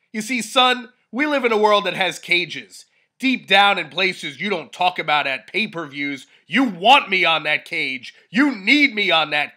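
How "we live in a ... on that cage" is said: ordinary talk, nothing marked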